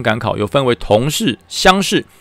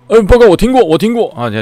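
higher and louder, bursting out; eager answer; 10 semitones higher, 5 dB louder than usual. Retold or imitated. Chubby soldier eagerly tells the commander, high and loud. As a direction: blurt it out like a keen recruit.

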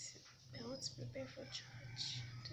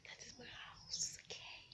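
whispering; 5 dB quieter than usual. Real, not acted. Whispered aside about house.

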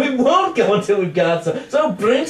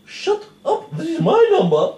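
indignant retort; 7 semitones higher than usual. Acted. Quarrel between singers.

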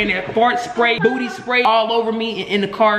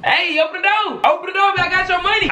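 calling out; 5 semitones higher than usual. Real, not acted. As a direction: holler to someone shut inside. Calling through door to partner.

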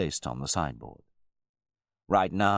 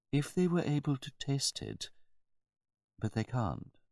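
higher-pitched, measured servant; deferential butler; 12 semitones higher, 3 dB quieter than usual. A narrator's reading. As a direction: answer the master with careful politeness. Butler answers his master.

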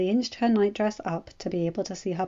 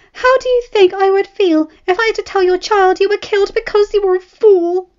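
much higher, louder angry shouting; wife furious at her reckless husband, shouting high; furious scolding wife; 13 semitones higher, 13 dB louder than usual. A narrator's reading. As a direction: tear into him for risking his life.

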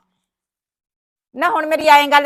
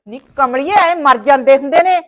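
usual speaking voice

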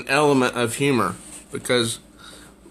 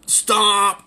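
stomp; 7 semitones higher than usual. Acted. Girls stomping on the eye.

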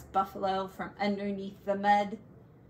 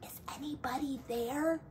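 much softer, timid call into the dark; softest voice; 5 semitones higher, 8 dB quieter, faster than usual. A narrator's reading. The bear peers into the dark cave, softest voice. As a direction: barely breathe the question. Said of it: "with his softest voice".

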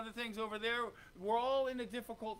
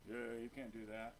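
much lower, softer sneering voice of the enemy; a sly, lower devil taunting; the enemy's voice; 11 semitones lower, 10 dB quieter than usual. Acted. The enemy taunting the believer, low and sneering.

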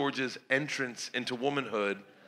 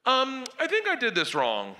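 put-on sheepish kid voice; 10 semitones higher than usual. Daughter's sheepish answer quoted.

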